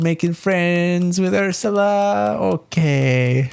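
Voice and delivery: put-on very high, slow baby-talk praise; cooing dog lover. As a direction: gush over the dog like a proud owner. Rose returns, coos at dog.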